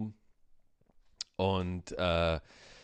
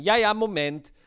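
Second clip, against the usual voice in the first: loud, much higher mock brush-off; dismissive brush-off; 10 semitones higher, 8 dB louder than usual. Bakery clerks brushing off the waiting customer, loud and mocking. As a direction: fob the customer off impatiently. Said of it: "Dann sagen die".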